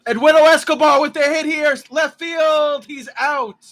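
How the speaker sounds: loud, high-pitched live call; excited play-by-play. Real, not acted. Host calling Escobar's hit to left field, excited shout.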